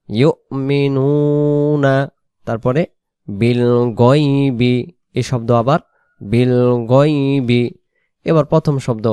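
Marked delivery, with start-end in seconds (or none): 0.1-1.9 ইউমিনুনা stretched very slowly; slow model recitation. Shown as a demonstration. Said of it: "এবার আপনি বলেন". 3.3-7.6 বিল গয়বি drawn slowly with long final vowel; slow recitation. Shown as a demonstration. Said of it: "শব্দে শব্দে টানবো".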